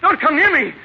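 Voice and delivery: high, loud, panicked pleading; a man screaming at his armed friend to stay away; terrified scream. Acted. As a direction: scream for your life. Henry faces a gun.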